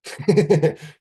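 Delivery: high squeaky laugh. Real, not acted. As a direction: giggle up high. Laugh at chungus.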